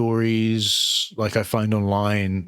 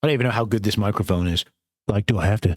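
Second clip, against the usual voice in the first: ordinary delivery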